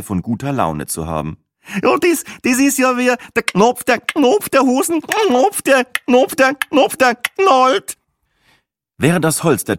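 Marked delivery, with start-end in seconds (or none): 1.8-8.0 high, tittering mock-excited voice; giddy Gruberin. Gruberin in a fit of good mood, high giggly mockery. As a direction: squeal it out like a tipsy tourist mocking.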